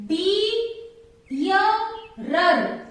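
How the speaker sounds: three separate beats with gaps between; syllable by syllable. Shown as a demonstration. Teacher letting students count syllables, each one set apart. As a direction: sound out each syllable on its own. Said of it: "How many syllables?".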